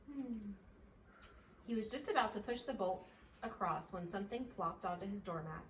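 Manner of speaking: plain reading voice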